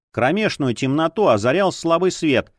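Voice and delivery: ordinary delivery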